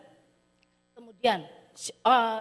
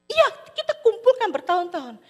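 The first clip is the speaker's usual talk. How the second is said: high, loud wailing complaint; wife protesting the loss; much higher, louder lament; upset wife; 10 semitones higher, 9 dB louder than usual. Acted. Wife laments lost silver.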